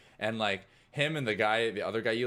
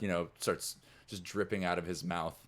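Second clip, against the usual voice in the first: ordinary talk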